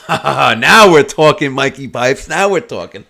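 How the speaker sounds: loud laughing 'now we're talking'; hammed-up gleeful laugh; laughing approval. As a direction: imitate the dealer's delighted laugh. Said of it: "And he goes".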